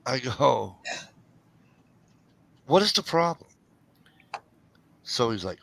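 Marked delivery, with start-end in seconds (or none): 2.7-3.5 higher-pitched retold challenge; exasperated. Worker challenging boss in retelling, exasperated. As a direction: pitch it up, fed up with the objection.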